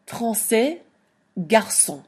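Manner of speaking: français and garçon with the ç said as S; teacher models the soft C. Shown as a demonstration. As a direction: give the ç an S sound. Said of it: "make the letter C sound like an S".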